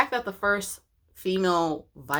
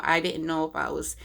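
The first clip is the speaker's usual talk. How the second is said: usual speaking voice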